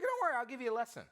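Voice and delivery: breezy dealer brushing it off; cheery salesman. Retold or imitated. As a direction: dealer breezily offering a lesson. Dealer reassuring, retold.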